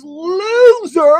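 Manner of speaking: Jim Carrey impression. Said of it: "in my Jim Carrey voice".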